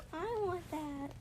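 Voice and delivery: high, slow, choked longing; tearful wish. Retold or imitated. Retelling wishing for her nephew's growth, high and tearful. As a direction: let the want crack the voice. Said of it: "And I was like".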